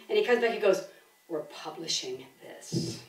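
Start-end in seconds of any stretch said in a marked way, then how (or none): 1.3-3.0 low, soft and slow pronouncement; slow right down for a quietly decisive quote; imitated solemn verdict. Host retells Alan returning from the manuscript, quietly decisive.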